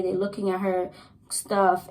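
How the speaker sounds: usual speaking voice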